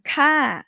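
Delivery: "ka" starts high and drops away; falling tone ka. Shown as a demonstration. Teacher shows students the falling tone on ka. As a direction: let "ka" fall from a high start. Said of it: "always gonna be falling tone".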